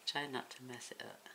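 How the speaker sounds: hushed, focused murmur; quiet concentrating. Real, not acted. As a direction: murmur while focusing hard. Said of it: "how in deep thought I am here".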